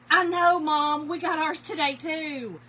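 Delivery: loud, high reply to Mom; excited holler back; calling out. Real, not acted. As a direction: call it out big and high, easing off at the end.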